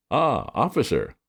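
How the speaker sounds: feeble Ah, officer, ah high then falling; weak ah. A narrator's reading. Bertie caught up a tree greets the policeman, weakly. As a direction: try to sound casual and fail. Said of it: "It sounded weak".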